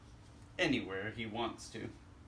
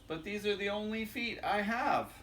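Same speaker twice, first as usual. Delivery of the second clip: put-on higher childlike protest; little critter's voice; 8 semitones higher than usual. Little critter answering dad about his feet, lightly higher. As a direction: lift it like a puzzled kid. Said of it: "And then he says".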